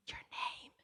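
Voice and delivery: a hushed whispered cue; prompting whisper. Retold or imitated. Counselor whispering a prompt beside the phone. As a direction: whisper the hint to the kid. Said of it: "I'll say".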